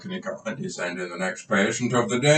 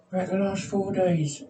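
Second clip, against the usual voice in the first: much higher, put-on voice of a timid patient; 10 semitones higher than usual. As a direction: play the nervous patient, pitched well up. Patient answers.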